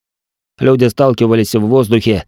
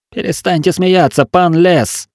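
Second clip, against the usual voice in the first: raised pitch, insistent; urgent pleading; 8 semitones higher than usual. A narrator's reading. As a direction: snap someone out of a laughing fit. Companion urges him to stop laughing.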